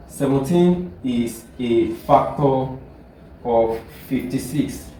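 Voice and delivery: slow, deliberate repeat for the class; teacher dictating slowly; slow dictation. Shown as a demonstration. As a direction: spell it out slowly so they can copy it. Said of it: "So another example is".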